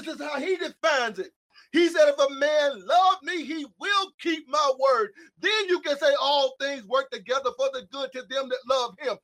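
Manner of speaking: impassioned sermon voice; preaching at full force. Acted. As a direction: thunder it at the congregation like a pulpit preacher.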